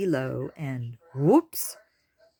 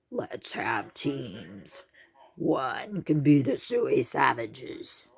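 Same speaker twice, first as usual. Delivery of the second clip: brazen boar voice; 3 semitones lower, slower than usual. A narrator's reading. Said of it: "a brawny boar with a brazen voice".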